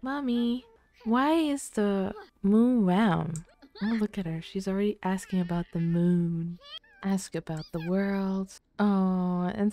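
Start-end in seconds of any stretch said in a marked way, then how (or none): 0.0-3.4 put-on high, slow curious kid voice; voicing the child. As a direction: ask like a wide-eyed little girl. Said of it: "Be like".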